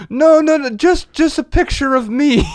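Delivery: high, quick mock woman's voice, hint of Italian accent; the woman correcting him. Italian tourist telling the host she wanted only herself, high mock voice. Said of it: "She goes".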